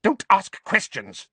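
high, sharp snap; snapped. A narrator's reading. Aunt refusing her nephew's questions, snapped. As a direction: shut him down sharply. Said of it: "Aunt Petunia snapped".

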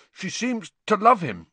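thick, through cream cake; mouth full. A narrator's reading. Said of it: "said Ron thickly".

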